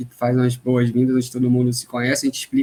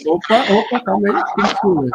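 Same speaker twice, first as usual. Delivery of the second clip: mock protest; 7 semitones higher than usual. Mock protest, high put-on voice.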